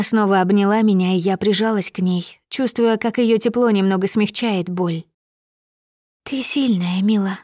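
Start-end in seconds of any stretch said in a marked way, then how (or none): 6.2-7.4 whispered pep talk. A narrator's reading. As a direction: whisper it close and comforting. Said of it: "прошептала она".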